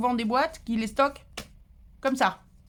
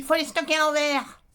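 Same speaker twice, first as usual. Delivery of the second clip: a curt, loud, higher command with no greeting; curt blunt order; 6 semitones higher, 5 dB louder than usual. Retold or imitated. Quoting a viewer who gave advice without hello, curt blunt order. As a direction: bark the order like someone who never says hello. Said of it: "Pourquoi je le dis sur ce ton-là?".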